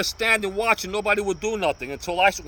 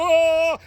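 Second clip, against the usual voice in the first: high, loud yelp; pained oh; 12 semitones higher, 7 dB louder than usual. Retold or imitated. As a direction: yelp as if just slapped. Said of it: "the guy go".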